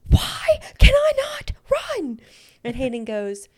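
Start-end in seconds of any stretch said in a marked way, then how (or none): 0.1-2.2 high, drawn-out exasperated wail; loud, whiny cry of frustration; frustrated yelling. Retold or imitated. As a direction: yell it slowly in despair, pitch way up. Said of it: "I'm, like, yelling".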